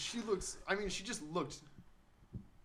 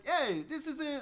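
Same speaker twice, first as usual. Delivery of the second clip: higher, badly faked Jersey accent; attempted Jersey accent; 9 semitones higher, much slower than usual. Imitating diners from New Jersey, shaky Jersey attempt. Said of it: "I don't know Jersey accent".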